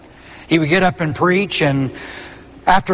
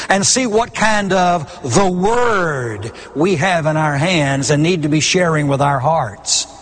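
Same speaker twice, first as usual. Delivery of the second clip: normal talking voice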